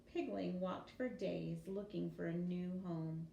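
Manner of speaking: plain reading voice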